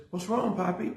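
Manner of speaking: put-on a touch of a toddler's concern; little daughter. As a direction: ask it the way a small child would. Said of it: "She says".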